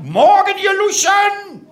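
high, slow bellowed greeting; drill sergeant bellow. Retold or imitated. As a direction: roar it at the crowd like a drill instructor. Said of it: "brüll die Leute an".

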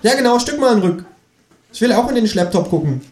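very high kangaroo character voice; cheeky kangaroo, insistent. A narrator's reading. Kangaroo butting in to see the laptop, high cartoon voice. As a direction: play a pushy talking kangaroo up high.

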